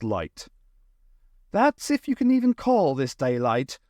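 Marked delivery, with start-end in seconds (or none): 1.5-3.8 higher-pitched, mildly mournful complaint; a touch of doleful grumbling. A narrator's reading. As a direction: lament it with understated gloom. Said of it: "Eloise said mournfully".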